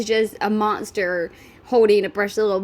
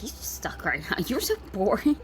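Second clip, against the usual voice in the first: voicing Xavier; 7 dB quieter than usual. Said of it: "Xavier's probably thinking ... He's like".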